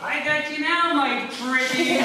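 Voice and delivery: high, loud witch impression, drawing out the last words; Wicked Witch. Rider coming up behind on horseback, mock wicked witch voice. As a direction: cackle it out like a storybook witch. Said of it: "The Wicked Witch of the North".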